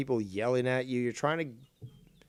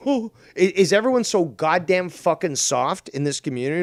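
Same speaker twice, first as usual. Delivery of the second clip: ordinary delivery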